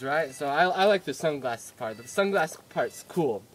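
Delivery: usual speaking voice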